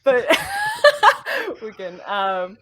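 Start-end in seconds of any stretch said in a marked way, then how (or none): 0.4-1.6 squealing high laugh; high burst of laughter. Real, not acted. Laughing during breath intro.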